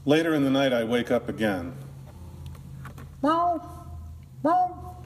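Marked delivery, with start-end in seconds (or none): 3.2-3.6 high, thin imitated meow; cat meow. Cat heard in the night. 4.4-5.0 high, thin imitated meow; cat meow. Cat heard in the night.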